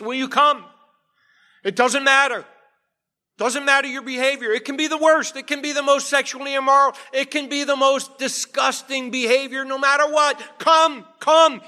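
high, loud, urgent altar call; full-force preaching. Acted. Preacher urging sinners to the feast, fiery and loud. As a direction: plead with the whole room to come.

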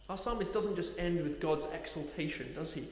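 usual speaking voice

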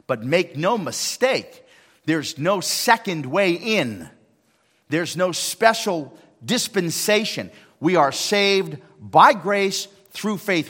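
slow, loud emphatic preaching; preaching at full force. Acted. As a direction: drive it home slowly and hard.

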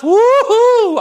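high, loud joyful woo-hoo; excited whoop. Real, not acted. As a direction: let out a happy whoop. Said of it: "I'm excited".

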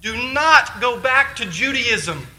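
high, impassioned exhortation; preaching at full force. Acted. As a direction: plead with the congregation to stay.

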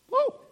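put-on high, loud toddler gasp of joy; high loud excited whoa; delighted toddler whoa. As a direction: squeal it like a thrilled little girl. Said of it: "and she just".

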